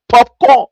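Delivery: popcorn said as "pop-kor" with the final n dropped; Liberian pronunciation. Shown as a demonstration. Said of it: "We say in our Liberian way".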